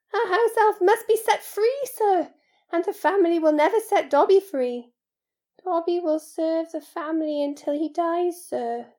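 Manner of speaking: high, loud house-elf voice; Dobby, resigned. Acted. House-elf explains his bondage to Harry, high and resigned. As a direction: pitch it up like a resigned servant elf.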